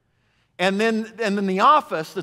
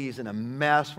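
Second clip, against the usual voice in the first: normal talking voice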